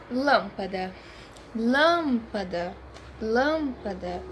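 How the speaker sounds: lâmpada slowly, LÂM leaned on; stress on LÂM. Shown as a demonstration. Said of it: "São divididas em três sílabas". (lâmpada devagar, forte no LÂM)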